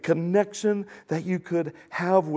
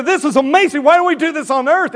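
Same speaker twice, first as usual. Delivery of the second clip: high, loud amazed exclamation; awestruck, loud, quickened delight; 12 semitones higher, 10 dB louder, faster than usual. Acted. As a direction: gush at full volume, pitch way up. Acts amazed people in heaven.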